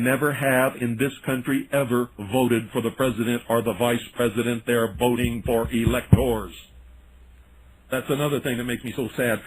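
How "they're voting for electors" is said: ordinary delivery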